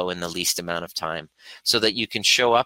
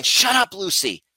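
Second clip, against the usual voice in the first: "Shut up" flung out much higher and louder, "Lucy" dropping back; snapping at the dog; 13 semitones higher, 4 dB louder than usual. Retold or imitated. Retelling how she snaps at barking Lucy, sharp high yell. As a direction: bark it at the dog, pitch shooting up. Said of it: "and going".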